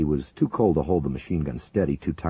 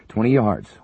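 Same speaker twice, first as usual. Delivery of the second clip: loud, higher shout; 6 semitones higher, 8 dB louder than usual. A narrator's reading. Wickham shouting the distance to Dimitri, loud. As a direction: call the distance over the noise. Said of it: "Wickham shouted to Dimitri".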